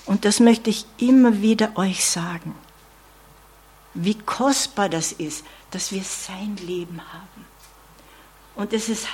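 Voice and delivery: usual speaking voice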